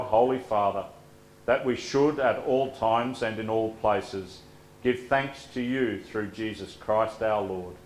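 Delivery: usual speaking voice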